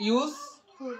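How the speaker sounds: "ইউজফুল" split into ইউজ and ফুল; syllable-by-syllable. Shown as a demonstration. Teacher counting syllables for students, "ইউজফুল" said syllable by syllable. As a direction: break "ইউজফুল" after ইউজ, pausing before ফুল. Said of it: "যেহেতু এটা এক syllable না?".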